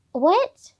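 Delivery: high, rising disbelieving what; incredulous, sharply rising question; baffled reaction. Retold or imitated. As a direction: re-enact a stunned one-word question. Retelling own baffled reaction.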